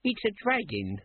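put-on high-pitched young guard; young guard's high voice; younger guard. A narrator's reading. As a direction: pitch it well up, as the junior guard. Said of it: "said the younger of the guards".

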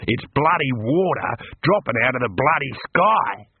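slow, emphatic astonishment; drawn-out, dumbfounded Aussie exclamation; amazed exclamation. A narrator's reading. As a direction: punch out each word in disbelief. Character amazed by first rain.

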